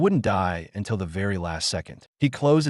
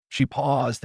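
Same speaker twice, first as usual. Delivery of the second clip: usual speaking voice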